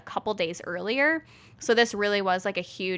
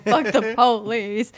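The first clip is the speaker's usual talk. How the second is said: slow, louder mock drawl through laughter; Forrest Gump; 6 dB louder, slower than usual. Host echoing the botched accent, laughing Forrest Gump. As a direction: stretch out a silly Gump accent. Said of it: "that was Forrest Gump".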